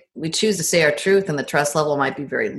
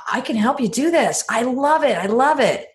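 eager; 7 semitones higher than usual. Retold or imitated. Retelling her eager take-over.

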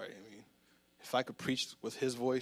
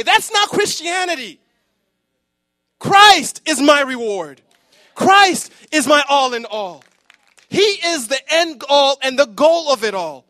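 much louder and higher, shouting; full-force preaching; 10 semitones higher, 17 dB louder than usual. Real, not acted. Pastor preaching to the church at full force, shouting. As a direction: preach it to the rafters.

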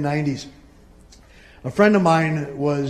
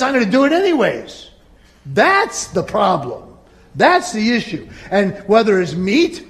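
louder, higher preaching voice; preaching at full force; 5 semitones higher, 5 dB louder than usual. Acted. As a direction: drive the point home to the church.

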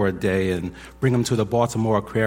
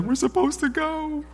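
much higher, tearful-sounding quote; tearful wife. Retold or imitated. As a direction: imitate the wife's emotional certainty. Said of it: "and she's crying".

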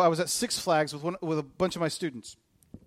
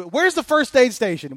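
retold frantic call; 7 semitones higher, 8 dB louder than usual. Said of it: "wandering up to anybody".

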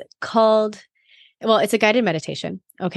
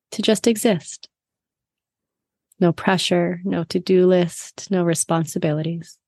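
guided meditation. Acted.

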